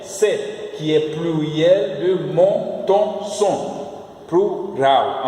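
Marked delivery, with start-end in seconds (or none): 4.3-5.3 "Plural" in two syllables, Portuguese plu-ral; Portuguese pronunciation. Shown as a demonstration. Teacher contrasts Portuguese with French, Portuguese pronunciation. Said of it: "Em francês, on dit pluriel".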